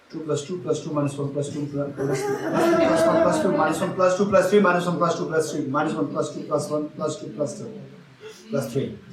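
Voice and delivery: rapid-fire numbers run together; speedy quiz reading; fast dictation. Shown as a demonstration. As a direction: rattle off the sum as fast as you can. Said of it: "I said it fast".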